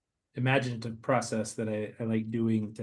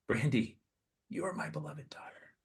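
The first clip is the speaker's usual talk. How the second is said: whispered Jesus; 5 semitones higher, 5 dB quieter than usual. Acted. Jesus speaks to listener.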